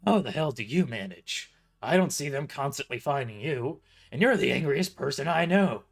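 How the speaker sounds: incredulous boss. A narrator's reading. Boss exclaims.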